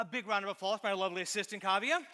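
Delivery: fast, loud showman intro; announcer hype. Real, not acted. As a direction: sell her name to the crowd. Announcing volunteer to the audience.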